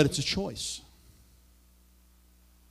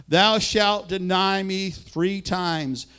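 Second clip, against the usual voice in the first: solemn slow quote; 3 semitones higher, much slower than usual. Acted. Jesus quoted in scripture reading.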